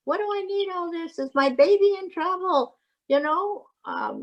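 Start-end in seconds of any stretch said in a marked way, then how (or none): 0.1-2.6 high, worried questioning; mock-anxious questions in a raised pitch; terrified mother, acted. Mother frightened by the machines and staff, acted worry. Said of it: "all those things terrify them".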